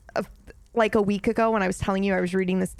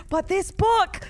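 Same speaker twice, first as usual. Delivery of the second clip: excited gushing; 11 semitones higher than usual. Real, not acted. Gushing about dragons.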